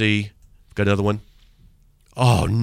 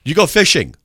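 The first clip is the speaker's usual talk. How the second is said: higher, louder silly accusation; mock angry emailer; 10 semitones higher, 8 dB louder than usual. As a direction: spoof a nonsense hater. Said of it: "You know".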